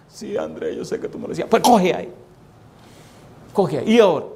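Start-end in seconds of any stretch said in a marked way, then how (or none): 0.1-1.5 whispered, sheepish admission; hushed guilty reply to God; meek whisper. Acted. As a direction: own up in a small whisper. He answers God meekly.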